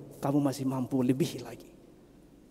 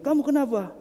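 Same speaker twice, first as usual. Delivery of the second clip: calling down; 12 semitones higher, 4 dB louder than usual. Acted. Third passer-by calls down.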